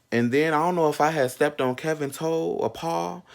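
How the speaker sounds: normal talking voice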